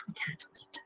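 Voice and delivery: very soft, unvoiced murmur of anticipation; hushed, barely audible yearning; longing whisper. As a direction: whisper it to yourself, tempted. Molly longing for the cake.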